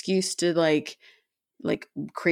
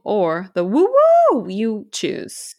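slow, louder, put-on slogan read; mock emphasis; 5 dB louder, much slower than usual. Shown as a demonstration. Friend jokingly stresses the slogan, slow and loud. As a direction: stretch it out like a grand motto.

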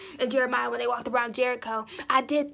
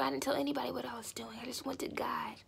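fast, much softer whisper; quick whisper; 8 dB quieter, faster than usual. Real, not acted. Testifier whispering that she told nobody. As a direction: confide it under your breath. Confiding secret prayer.

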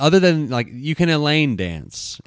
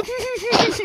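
high mocking laugh. Mocking laugh after taunt.

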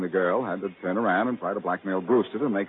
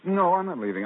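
louder and higher, springing the trap; firm retort; 9 semitones higher, 5 dB louder than usual. Acted. Detective answers the suspect's taunt, louder and higher. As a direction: turn the tables with a raised voice.

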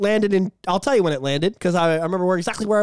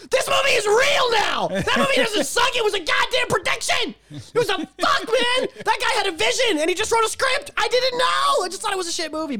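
frantic high rant; 11 semitones higher than usual. Real, not acted. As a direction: rant in a panic. Ranting in mock terror.

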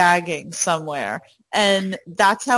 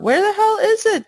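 high-pitched frustration; 13 semitones higher, 4 dB louder than usual. Real, not acted. Searching notes, frustrated.

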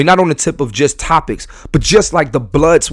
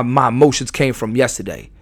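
ordinary talk, nothing marked

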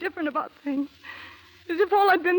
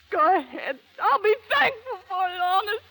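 higher, voice cracking into a cry; breaking into tears; 5 semitones higher, 3 dB louder than usual. Acted. Anna tells Matt to kill her, crying. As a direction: plead through rising tears.

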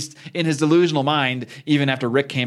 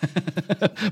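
laugh; 6 semitones higher, 4 dB quieter than usual. Real, not acted. Laughing before show outro.

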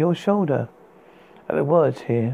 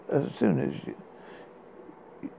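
whisper; 10 dB quieter, much slower than usual. Acted. Poem line restarted, whispered.

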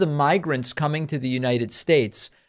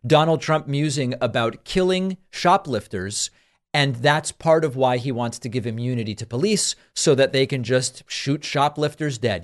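ordinary talk, nothing marked

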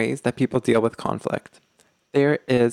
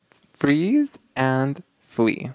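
freeze and flee stretched very slowly; drawn-out label; much slower than usual. Shown as a demonstration. Host to podcast listeners, naming the style, very slow and deliberate. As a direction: lay each word out slowly for the listeners.

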